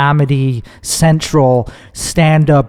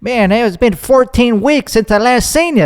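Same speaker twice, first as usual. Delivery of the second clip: higher mocking voice, overly precise; mock acquaintance; 8 semitones higher than usual. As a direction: imitate someone counting weeks since they saw you. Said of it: "It's like".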